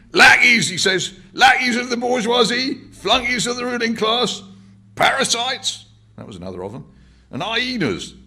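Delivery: old man imitating a ranting speaker; powerful street orator. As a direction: thunder it like a Hyde Park agitator. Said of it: "real powerful speaker he was".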